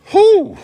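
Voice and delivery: loud, very high "whew"; sudden loud whew, way up high; high whoop. Real, not acted. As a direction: let out a big high whoop. Reacting on opening the hot cooker.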